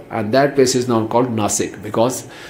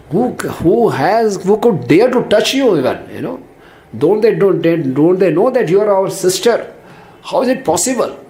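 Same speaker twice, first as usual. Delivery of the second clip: indignant brothers; 6 semitones higher than usual. Retold or imitated. Brothers demanding who hurt their sister, outraged and higher. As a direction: demand to know who did it, rising in outrage.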